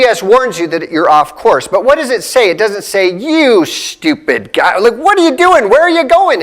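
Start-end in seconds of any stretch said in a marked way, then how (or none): 3.2-6.4 higher, impatient rebuke building up; mock harsh scolding from a GPS. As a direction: scold like an exasperated nag. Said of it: "It doesn't do that".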